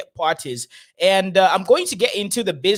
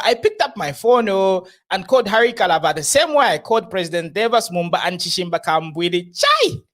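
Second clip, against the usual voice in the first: mock Nigerian accent. Host reading the prophet's boast in a light mock Nigerian accent. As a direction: read it like the prophet would. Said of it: "This is not how he talks".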